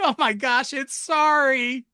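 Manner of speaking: high, jeering correction, drawn out on "sorry"; mock them with a high, slow "sorry"; hammed-up scoffing at the way "sorry" was said. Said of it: "they just laugh at you".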